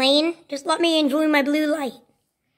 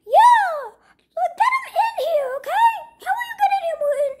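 very high, piping argumentative voice; squeaky little character; 12 semitones higher than usual. Acted. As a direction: argue in a tiny squeal. Squeaky character argues back.

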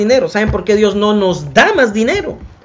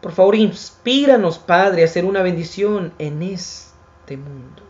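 hushed prayer; 4 semitones lower, 8 dB quieter than usual. Acted. Preacher closing with a prayer for his listeners, soft and reverent. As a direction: pray quietly, as if alone with God.

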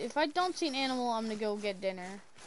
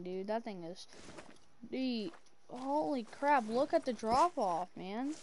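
ordinary delivery

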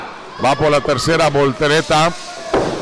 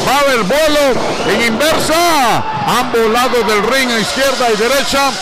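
excited play-by-play; 7 semitones higher than usual. Acted. Live wrestling play-by-play.

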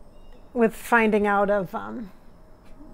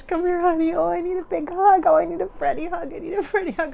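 high, louder, eager gush; gushing welcome; 8 semitones higher, 5 dB louder, faster than usual. Real, not acted. Psychic welcoming Freddie's spirit, high eager gush. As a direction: throw your arms out for a hug.

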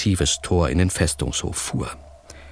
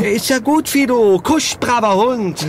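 much higher, slow sing-song coaxing; coaxing a dog; 5 dB louder, slower than usual. Acted. Hedge calms the guard as if he were a dog, mock coaxing. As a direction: soothe him like a growling dog.

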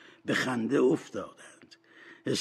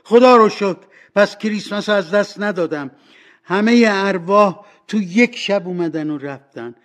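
high, loud cry of relief; joyful shouting; 9 semitones higher, 12 dB louder than usual. Acted. Scrooge shouting thanks that he has not missed Christmas. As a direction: exclaim thankfully at full voice. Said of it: "فریاد زد".

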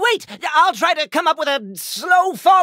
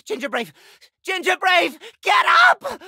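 urgent shout; 3 semitones higher than usual. Acted. Waking up a fallen friend.

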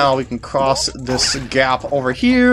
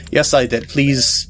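ordinary talk, nothing marked

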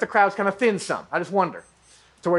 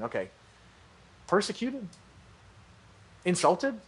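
quiet, wary voice of an unsure listener; hesitant doubt; 7 dB quieter than usual. Retold or imitated. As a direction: hold back each word as if stepping away. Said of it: "like you saying".

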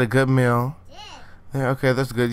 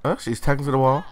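normal talking voice

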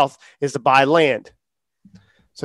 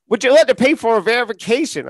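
a higher, put-on salesman voice; mock scammer; 7 semitones higher than usual. Mocking scammers selling him verification, in a higher put-on voice. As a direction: parody a scam message.

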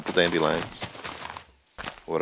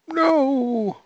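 sudden high, loud no; startled cry; 7 dB louder than usual. Real, not acted. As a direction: yelp in shock as it blows up. Creeper explodes.

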